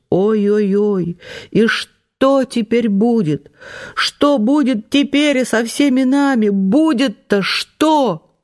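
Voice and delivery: high, slow lamenting wail; wail, dreading what comes next; dramatic wail. A narrator's reading. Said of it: "прорезался драматический вопрос". (драматичный плач)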